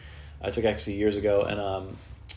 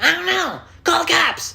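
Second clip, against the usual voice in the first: high-voiced roommate; 8 dB louder, slower than usual.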